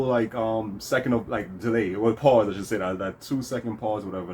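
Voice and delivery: ordinary delivery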